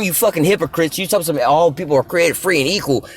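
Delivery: normal talking voice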